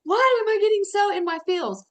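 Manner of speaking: high, loud, overwhelmed cry of disbelief; bewildered outburst. Retold or imitated. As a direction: blurt it out, caught off guard by emotion. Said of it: "And I was like".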